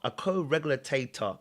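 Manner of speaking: usual speaking voice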